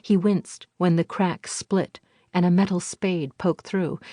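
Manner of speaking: plain reading voice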